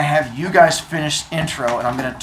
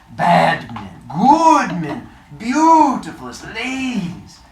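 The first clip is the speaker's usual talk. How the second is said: higher, slower immigrant father voice; father's voice; 4 semitones higher, 3 dB louder, slower than usual. Acted. Teacher voicing the father in the book, higher and slow. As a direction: voice the old father fondly.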